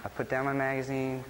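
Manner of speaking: normal talking voice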